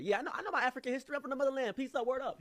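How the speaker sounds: high, loud mock street voice; imitated rowdy young man. Rowdy stranger boasting of African history, high mock voice. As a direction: boast loudly in a high-pitched caricature.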